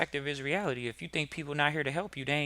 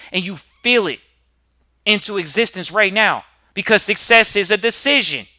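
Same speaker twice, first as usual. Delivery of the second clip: loud, higher, rising preacher's cry; preaching at full force; 6 semitones higher, 15 dB louder than usual. Acted. Motivational host firing up his audience, full preaching shout. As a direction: drive it home like a sermon peak.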